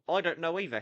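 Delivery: ordinary delivery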